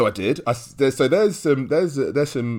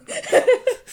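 burst of laughter; 6 dB louder than usual. Real, not acted. Laughing at mum story.

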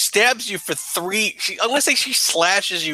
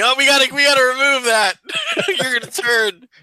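higher, louder hammed-up panic about the wound; mock zombie movie character; 10 semitones higher, 4 dB louder than usual. Mocking a zombie movie survivor over a bitten arm, hammed-up panic. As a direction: ham up a horror-movie survivor. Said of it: "It's like a zombie movie".

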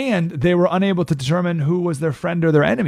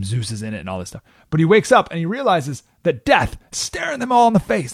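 ordinary talk, nothing marked

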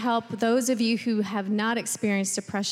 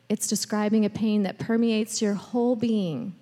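usual speaking voice

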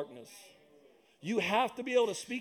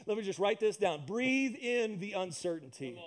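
normal talking voice

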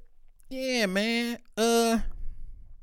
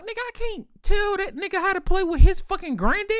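high, pitched-up retold complaint; high-pitched mock exasperation; exasperated protest. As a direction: voice yourself insisting, way up high. Retold own protest to bro.

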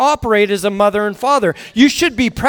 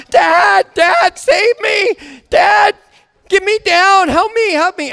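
imitated panicked child, high-pitched yelling; freaking out; 8 semitones higher, slower than usual. Stuck daughter calling to dad from jungle gym, panicked. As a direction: act out a kid shrieking for rescue. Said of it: "she's freaking out".

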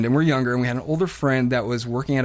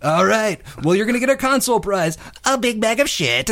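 mock game show host; 8 semitones higher than usual. Prankster telling caller their prize in a mock host voice. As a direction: deliver the punchline like a cheesy host.

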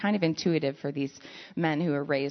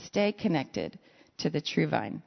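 normal talking voice

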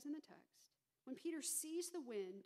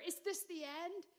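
crying out in fear; 5 semitones higher, 4 dB louder than usual. Acted. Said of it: "crying out in fear".

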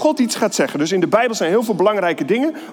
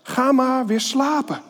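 put-on old priest Eli; 7 semitones higher than usual. A narrator's reading. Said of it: "Maar Eli antwoordde".

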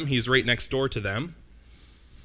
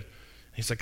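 whispered aside; 13 dB quieter than usual. Real, not acted. Dropped aside.

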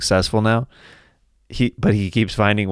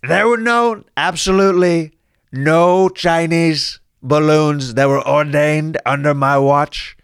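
higher, boastful presidential caricature; Donald Trump; 6 semitones higher than usual. Acted. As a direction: deny it all with emphatic, spaced-out phrases. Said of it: "Chinese balloons during Trump's presidency".

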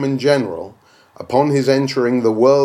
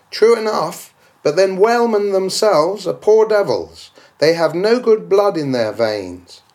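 objector's voice; 7 semitones higher than usual. Acted. Voiced objector concedes and sneers.

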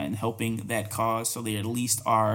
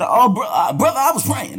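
high, loud mocking whine; mock excuse; 10 semitones higher, 8 dB louder than usual. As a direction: squeal it like a caught liar. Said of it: "So he said".

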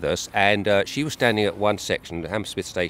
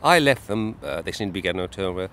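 ordinary talk, nothing marked

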